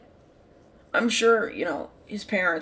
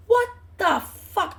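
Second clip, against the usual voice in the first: much higher, louder and slow; stunned disbelief; 12 semitones higher, 5 dB louder, slower than usual. Retold or imitated. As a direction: drag it out in pure shock. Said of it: "I obviously didn't say that".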